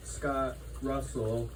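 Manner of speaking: normal talking voice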